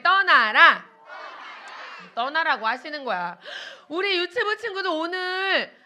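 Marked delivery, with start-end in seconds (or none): 0.0-0.8 put-on loud command for children to echo; call to repeat. Shown as a demonstration. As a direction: lead the class with a loud, firm call. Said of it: "자, 따라해볼게요".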